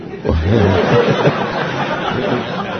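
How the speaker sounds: breathy amused laugh. Real, not acted. Telling how the boss became his disciple, amused laugh. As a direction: laugh lightly for a few seconds.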